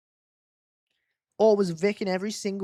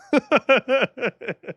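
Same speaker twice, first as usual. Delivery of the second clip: high, loud burst of laughter; real giggling fit, starting loud and trailing; 7 semitones higher, 5 dB louder than usual. Real, not acted. Streamer cracks up at own mistake.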